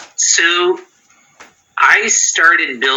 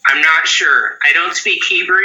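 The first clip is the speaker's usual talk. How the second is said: normal talking voice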